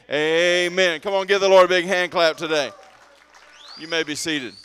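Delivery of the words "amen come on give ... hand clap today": loud call to the congregation; rally the room to applause; rousing amen. Real, not acted.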